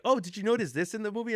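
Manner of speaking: high-pitched, eager retold kid; excited kid. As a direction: quote an excited little girl, pitched high. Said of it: "And she was like".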